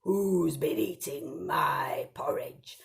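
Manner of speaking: slow, breathy whispered big bear; Daddy Bear. Acted. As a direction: draw it out slow and hushed like a suspicious bear. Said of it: "Daddy Bear went up and he said".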